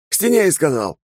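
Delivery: high, harsh shouted command through a mask; masked soldier order. Acted. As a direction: bark the order like a masked trooper.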